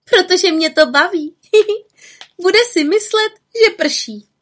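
high, loud, gleeful giggle; chuckling. A narrator's reading. Little witch explains her prank to the raven, chuckling. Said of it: "Čarodějnice se pochechtávala".

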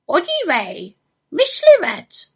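high, squawking raven voice; croaking raven. A narrator's reading. Raven points out a hunter below to the witch, croaking. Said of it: "zakrákal po chvíli Havran Abraxas".

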